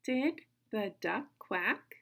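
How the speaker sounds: slow, word-by-word read-along question. Shown as a demonstration. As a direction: read "Did the duck quack?" slowly for children to follow. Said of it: "Read it with me again".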